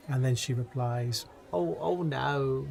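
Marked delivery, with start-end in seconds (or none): 1.5-2.7 put-on slow, drawn-out old lady refusal; lightly voiced old lady, in no hurry; a hint of a doddery old lady.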